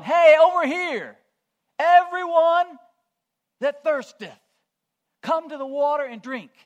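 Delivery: loud, high, slow crier's shout; prophet calling out. Acted. Prophet Isaiah inviting the thirsty to drink, loud high calling. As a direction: call out to everyone across a crowded square. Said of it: "You've heard him".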